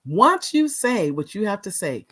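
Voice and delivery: ordinary talk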